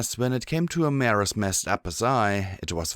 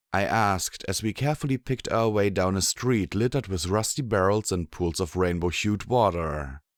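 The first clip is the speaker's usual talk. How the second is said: ordinary delivery, nothing marked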